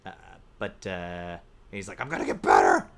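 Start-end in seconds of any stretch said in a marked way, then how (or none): 2.0-2.9 loud, hammed-up outburst; mock angry yell. Podcaster acting out a TV character's vow, mock angry yell. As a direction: bellow it as an imitation.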